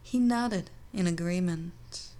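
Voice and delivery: plain reading voice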